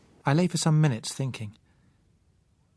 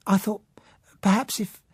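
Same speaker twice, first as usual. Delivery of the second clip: faltering; 8 semitones higher, 4 dB louder than usual. A narrator's reading. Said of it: "her voice faltered".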